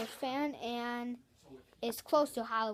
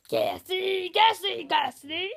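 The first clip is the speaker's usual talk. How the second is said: loud, higher belched chant; gassy burp call; 7 semitones higher, 11 dB louder, slower than usual. Shown as a demonstration. Kid ending his burp video with a loud gassy chant. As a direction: belch the word out three times. Burping sound demo finale.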